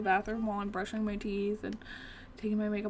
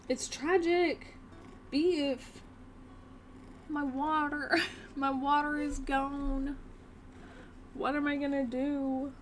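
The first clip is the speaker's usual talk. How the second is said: higher, drawn-out mock lament; mock tragic; 5 semitones higher, slower than usual. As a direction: playfully wail over a tiny disaster. Mock lament over lost water.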